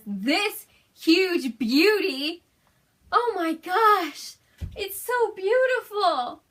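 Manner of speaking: high, slow, loud awe; high awed gasping praise; awed delight. Real, not acted. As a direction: marvel at the gift. Shows merch gift, awed.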